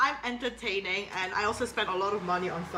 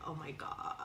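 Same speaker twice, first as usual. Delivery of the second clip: Valley Girl impression; 6 semitones lower, 13 dB quieter than usual. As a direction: do a hushed, lower-pitched Valley Girl. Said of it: "I can do, like, the Valley Girl".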